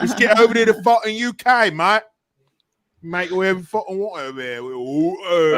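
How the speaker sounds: rowdy put-on British bloke; mock British accent. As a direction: lay on a thick British accent. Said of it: "talking to me in that accent".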